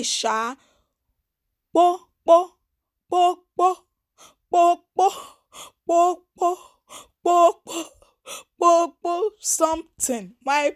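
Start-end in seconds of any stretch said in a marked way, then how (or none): 1.7-9.3 high, loud "po, po" like a drumbeat; mock banging noise; imitated popping sound. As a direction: pop out each "po" sharply and high. Imitating a beat or bang.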